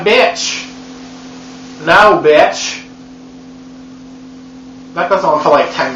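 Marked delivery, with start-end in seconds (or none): 0.0-2.8 high sharp Bitch, then a rising No, bitch; sassy refusal. Retold or imitated. Edward refuses Bella again, sassy mock refusal. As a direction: shut her down with attitude. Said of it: "And he's like".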